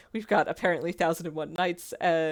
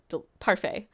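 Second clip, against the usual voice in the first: ordinary delivery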